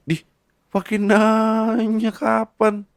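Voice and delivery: high, loud, slow stretched disbelief; high drawn-out indignant retort. Acted. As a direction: stretch it out, mock outraged.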